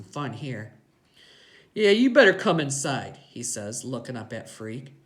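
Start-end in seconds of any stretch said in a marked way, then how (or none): 1.7-3.2 louder, raised biker voice; domineering gang boss; Iggy, bossy biker. A narrator's reading. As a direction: order the kids in, loudly.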